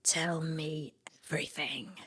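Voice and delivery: hushed, whispered Cobell impression; quoted Cobell line. Host quoting Cobell on the phone, hushed whisper. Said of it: "and she says".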